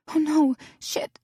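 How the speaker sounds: high, panicked yelp; startled cursing. A narrator's reading. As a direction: blurt it out in panic. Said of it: "McKinley cursed to herself".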